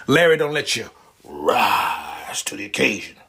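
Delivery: usual speaking voice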